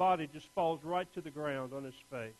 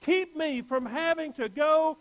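higher, louder pleading cry to God; desperate prayer; 8 semitones higher, 8 dB louder than usual. Pretend. Pastor voicing the believer's plea, desperate cry. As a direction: beg God to spare you.

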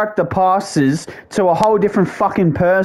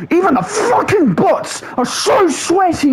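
high, strained shout of frustration; raging complaint; 12 semitones higher than usual. Real, not acted. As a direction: yell it in high-pitched exasperation. Rages at the game's bots.

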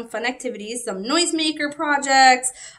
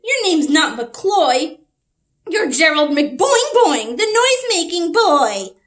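loud scolding voice; 4 semitones higher, 8 dB louder than usual. Acted. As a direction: boom it out like a cross grown-up. A character scolds Gerald.

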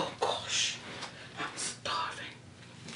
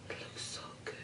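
breathy hushed whisper at the food; whispered appreciation of the dish; 25 dB quieter, faster than usual. Real, not acted. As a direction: whisper it close to the mic. Close-up food shot, hushed.

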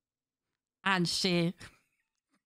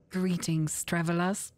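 formal roleplay greeting; 8 semitones lower than usual. Acted. Shorter roleplay greeting rehearsed.

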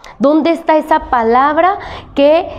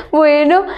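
laugh; 3 semitones higher than usual. Real, not acted. Teacher laughs at own joke.

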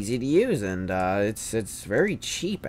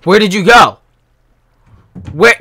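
high, loud "Where did you go?"; frantic loud searching; shouted demand; 8 semitones higher, 15 dB louder than usual. Real, not acted. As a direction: yell it across the map at the runner. Hunting a fleeing player.

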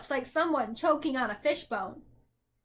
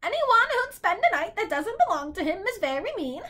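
high, swooping bird voice; scolding bird; 7 semitones higher, 4 dB louder than usual. A narrator's reading. Bird character scolding Milo.

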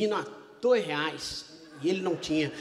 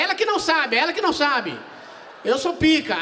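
loud, high cocky boast; husband boasting to skiers, cocky and loud; bragging; 7 semitones higher, 7 dB louder than usual. Retold or imitated. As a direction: show off to the crowd. Bragging to onlookers.

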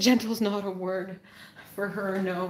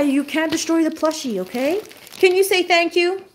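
high pet-talk voice; 7 semitones higher than usual. Real, not acted. Owner coaxing her dog to behave, in high pet talk.

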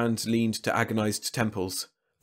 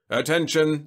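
crackling loudspeaker; 7 semitones higher, 6 dB louder than usual. A narrator's reading. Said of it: "Starzar's voice crackled".

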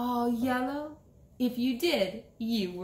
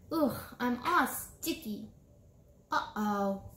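ordinary delivery

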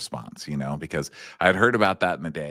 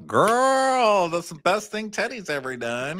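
campy gushing; 11 semitones higher, slower than usual. Acted. Gushing camp cheer.

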